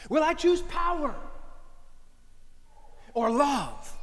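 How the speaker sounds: rising, emphatic sermon questions; preaching. Acted. Preacher challenging the congregation, at full force. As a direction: hammer each choice home.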